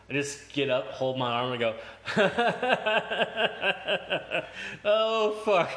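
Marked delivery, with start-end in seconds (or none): 1.8-4.8 high giddy laugh at his own fall; laughing in shock after landing on his arm; burst of laughter. Real, not acted. Laughing to classmates right after the fall. 4.8-5.7 laughing curse. Retold or imitated. Reaction after hurting arm.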